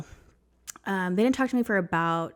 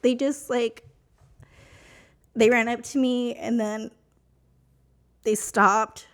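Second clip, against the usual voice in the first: emotional; 3 semitones higher than usual. Real, not acted. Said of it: "I get emotional about it".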